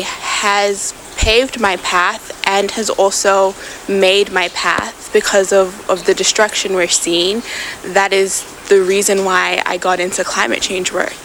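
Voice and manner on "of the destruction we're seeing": ordinary delivery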